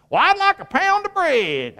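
high put-on shopper voice, asking politely; customer asking for bread. Preacher playing a customer buying a pound of bread, high put-on voice.

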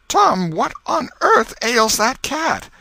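put-on an old aunt aghast at the wild cat; Aunt Polly shocked, calling to Tom; startled old aunt.

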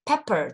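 "Pepper" with a short e, as in pep; "Pepper" starting with a short "pep"; short vowel. Shown as a demonstration. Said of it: "这也是短音的pep".

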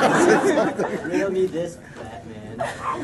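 villain voice. Acted. Riddler poses riddle to Batman.

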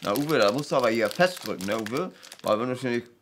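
talking round a cigarette; cigarette in mouth. Retold or imitated. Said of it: "Mit Zigarette im Mund so".

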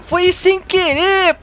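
high, very slow whiny plea; drawn-out whine. Real, not acted. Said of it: "Falei errado".

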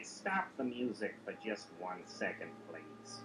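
low, soft, polite voice; a hint of mild-mannered neighbor; lightly voiced Cleveland. Cleveland interrupts the song.

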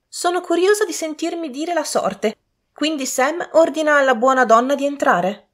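put-on high, imperious young lady. A narrator's reading. Lady ordering the fortune-teller shown in, imperious voice.

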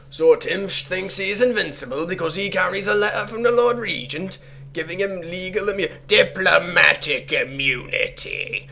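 high, loud put-on accent, vaguely Jamaican; bad pseudo-Jamaican accent. Player reading out a letter about Timsh, bad pseudo-Jamaican accent. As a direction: strain through a shaky attempt at a Jamaican accent. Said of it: "Trying to maintain this accent".

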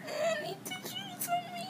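high tearful wail; crying out, upset. Real, not acted. Patient crying after surgery.

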